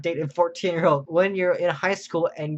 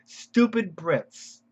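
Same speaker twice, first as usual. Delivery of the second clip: slow, higher and drawn-out Stupid Brits; mock comment reading; 3 semitones higher, much slower than usual. Reading out a viewer's comment about Brits, slow and mocking. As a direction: stretch out Stupid Brits, savouring a silly comment. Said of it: "just simple as that".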